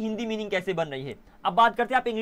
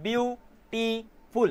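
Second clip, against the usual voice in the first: Beautiful very slowly in three separate pieces, view, टी, फुल; split into syllables; 3 semitones higher, much slower than usual. Shown as a demonstration. Teacher dividing a word for the class, beautiful syllable by syllable. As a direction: break beautiful up with a pause after each syllable. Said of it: "ये तीन टुकड़ों में divide हो गया".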